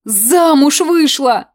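slow, high delighted cry; a happy old aunt exclaiming; joyful exclamation. A narrator's reading. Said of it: "обрадовалась Алевтина".

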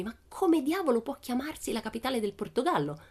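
mock puzzlement in a girl's voice; puzzled Pippi. Pippi imagines lying awake, mildly puzzled girl.

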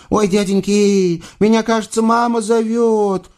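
put-on high-pitched child's exclamation; small girl suddenly remembering mum; little girl. A narrator's reading. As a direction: call out in a high voice, drawing out the end. Little girl hears her mother.

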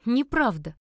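loud, high, sudden cry of denial; rude outcry. A narrator's reading. Heroine blurts out at the old man opposite, crying out rudely. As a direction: blurt it out before you can stop yourself. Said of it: "Вскрик, неподобающе грубый и бестактный".

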